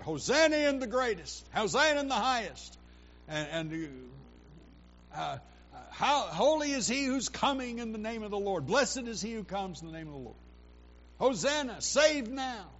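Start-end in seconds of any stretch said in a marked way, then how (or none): 0.0-2.8 loud, high cry of praise; crowd crying out. Retold or imitated. Crowd hailing Jesus as he enters Jerusalem, crying out. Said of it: "they're crying out". 5.9-12.7 raised voice, high acclaiming calls; crowd crying out. Retold or imitated. Crowd welcoming Jesus into Jerusalem, crying out. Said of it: "they're crying out".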